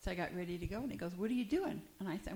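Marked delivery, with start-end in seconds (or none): 1.2-1.8 surprised husband. Retold or imitated. Husband confronting wife.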